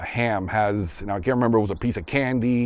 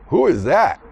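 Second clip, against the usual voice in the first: blurted out loud; 7 semitones higher, 7 dB louder than usual. Retold or imitated. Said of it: "mistake of saying out loud".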